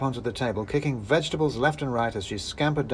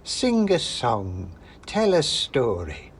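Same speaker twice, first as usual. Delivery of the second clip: slow, drawn-out crone voice, a little louder; a witch toying with her captive; slow, menacing witch; 3 semitones higher, 4 dB louder, slower than usual. Acted. Witch demands entertainment from her guest, slow and menacing. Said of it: "said the old woman".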